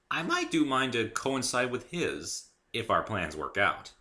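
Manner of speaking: plain reading voice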